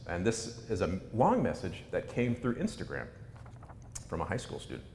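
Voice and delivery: usual speaking voice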